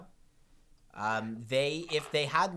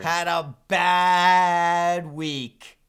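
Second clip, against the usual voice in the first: mock announcer; 4 semitones higher, 11 dB louder, much slower than usual. Real, not acted. Teasing Paul about losing.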